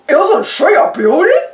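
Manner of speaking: a hint of grinning cartoon dope; Goofy voice. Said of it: "Goofy grinned".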